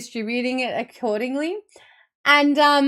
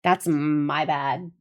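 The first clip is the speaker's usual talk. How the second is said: low, slow mock apology; drawn-out lightly sheepish aside; retold sheepish admission; 7 semitones lower, much slower than usual. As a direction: drag it out low, owning the slip. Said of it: "I was like".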